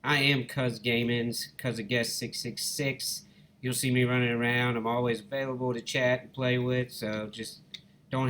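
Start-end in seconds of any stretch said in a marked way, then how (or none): none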